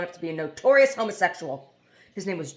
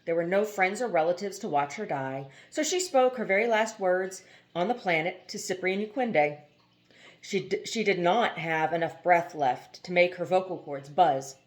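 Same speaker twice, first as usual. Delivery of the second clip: ordinary delivery, nothing marked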